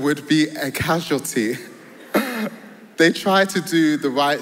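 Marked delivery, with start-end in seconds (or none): 1.6-2.9 a quick breathy laugh at the punchline; light chuckle over the story; short amused laugh. Real, not acted. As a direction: laugh at how it turned out. Laughs at own example.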